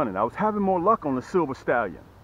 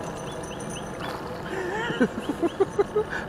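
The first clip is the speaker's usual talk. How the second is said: long, high gleeful laugh; wheezy excited laughter; high giggle; 4 dB quieter than usual. Real, not acted. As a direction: giggle away in delight, very high. Delighted after catching a fish.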